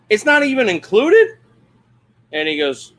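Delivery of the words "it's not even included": high, loud incredulous question; indignant disbelief. Retold or imitated. Camper hears about the pump truck fee, incredulous. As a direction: shoot the question up, loud and affronted. Retold objection to extra fee.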